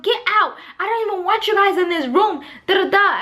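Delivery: high-pitched mock outburst; retold irritation. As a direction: act out ordering the family away. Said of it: "I'm getting irritated".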